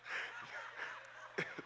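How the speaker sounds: silent wheezing laugh; laughing fit without voice; breathless laugh. Real, not acted. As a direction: laugh helplessly at the joke. Laughs at hoe cake.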